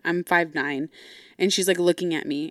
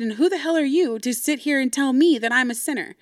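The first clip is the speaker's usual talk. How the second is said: higher-pitched, affronted comeback; retold indignant challenge; 6 semitones higher than usual. Daughter challenges dad for calling her a sinner, indignant. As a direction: re-enact throwing the accusation back at him.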